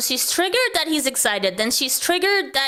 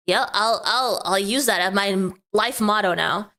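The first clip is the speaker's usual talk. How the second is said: ordinary delivery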